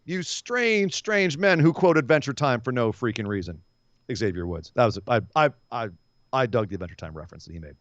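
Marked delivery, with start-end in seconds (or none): 0.1-3.6 hammed-up outrage at the tag champs; mock indignant wrestler. Voicing Elias and Riker.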